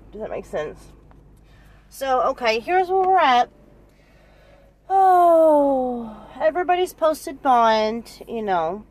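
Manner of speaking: ordinary talk, nothing marked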